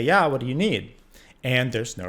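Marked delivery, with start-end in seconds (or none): 0.0-0.9 calling out through the door, somewhat louder; retold yell. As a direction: call back from the shower. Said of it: "I yell back and I say".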